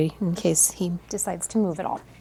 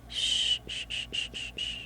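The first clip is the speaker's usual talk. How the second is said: soft repeated shush; soothing shushing; 12 dB quieter than usual. Real, not acted. Photographer settling newborn, gentle shushing. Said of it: "shushing the baby".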